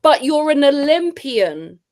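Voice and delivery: incredulous, drawn-out comeback; exasperated retort. Acted. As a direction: stretch it out in mock exasperation. Acted retort to the athlete.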